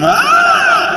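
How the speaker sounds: high startled scream; sudden loud shriek. Pretend. Contestant screams.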